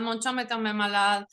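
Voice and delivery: ordinary delivery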